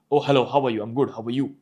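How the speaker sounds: low-pitched everyday greeting. Shown as a demonstration. Coach explaining pitch further, low-pitched. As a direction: keep the hello down low. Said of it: "The only difference was pitch".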